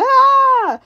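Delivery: high, loud imitated scream; a storyteller's imitation death scream; mock scream. Tom as the monster attacks, mock scream.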